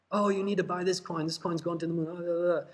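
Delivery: sarcastic imitation of a crypto shill; mocking shill. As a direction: parrot the paid YouTuber with scorn. Mocking paid crypto YouTuber hype.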